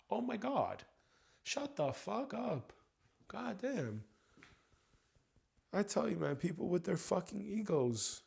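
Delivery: exasperated outburst. Retold or imitated. Telling off the mother for her ego, exasperated. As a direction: lose patience with her.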